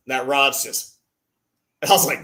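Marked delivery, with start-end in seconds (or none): none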